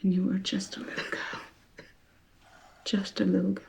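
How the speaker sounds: hushed, tender whisper. Real, not acted. Facilitator comforts grieving participant.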